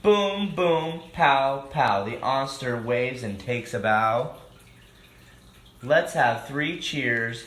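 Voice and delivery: lively rhyming storybook read-aloud; reading to children. A narrator's reading. Host reading a picture book to children, lively. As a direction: read the rhymes out to the kids.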